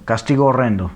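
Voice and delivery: one smooth unbroken reading; vowels joined. Shown as a demonstration. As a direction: merge the o sounds in "castigo horrendo". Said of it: "se une".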